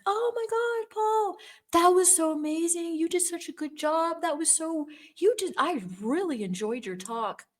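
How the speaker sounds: high-pitched, over-the-top gushing; mock-sweet, squealing flattery; gushing fake praise. Ex fakes praise to the elder.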